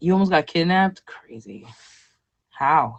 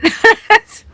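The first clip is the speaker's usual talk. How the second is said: a sudden squealing laugh, much higher and louder; high shrieking laugh; 12 dB louder than usual. Real, not acted. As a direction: let out a squeaky burst of laughter. Laughs at own mock crying.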